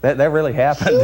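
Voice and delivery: telling it through laughter; amused. Real, not acted. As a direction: insist it's true while laughing, pitch lifted. Amused at own hunting story.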